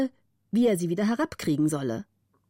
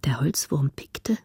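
whispered narration; 4 semitones lower than usual. A narrator's reading. Narrator whispering the creepy moonlit hall, hushed. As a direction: hush the telling as night creatures stir.

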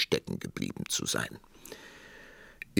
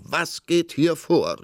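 high, loud, drawn-out demand; calling out; 11 semitones higher, 6 dB louder, slower than usual. A narrator's reading. King storming in on the crying queen, calling out. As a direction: burst in with a slow, raised-voice question. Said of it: "rief er aus".

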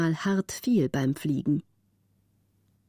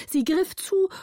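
tense narration; 11 semitones higher than usual. A narrator's reading.

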